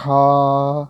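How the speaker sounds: ہا held long and loud from the throat; drawn-out letter name. Shown as a demonstration. Teacher spells for students, long loud ہا. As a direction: stretch ہا as in spelling. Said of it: "چلیں ہجے کر لیتے ہیں".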